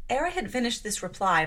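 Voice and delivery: usual speaking voice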